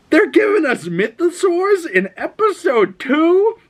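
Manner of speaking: very high, slowed, amazed outcry; thrilled disbelief. Retold or imitated. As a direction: relive the moment you couldn't believe it. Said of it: "I was like".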